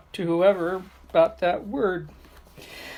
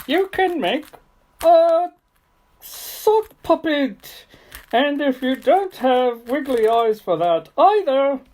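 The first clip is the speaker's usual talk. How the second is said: higher playful puppet voice; sock puppet voice; 6 semitones higher, 3 dB louder than usual. Pretend. Giving a craft tip to kids at home as a higher sock puppet. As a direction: pitch it up as the puppet talking.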